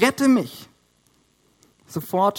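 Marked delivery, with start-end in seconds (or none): 0.0-0.7 mild cry. Acted. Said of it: "‚Herr‘, schrie er".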